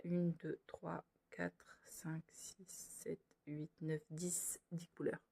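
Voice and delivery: very soft, near-whispered count; counting under breath. Real, not acted. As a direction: count quietly to yourself. Counts colours under her breath. (compter tout bas, presque en chuchotant)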